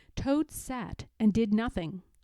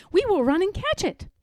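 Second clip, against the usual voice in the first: urgent; 7 semitones higher, 4 dB louder, faster than usual. Acted. Frog urges hurry.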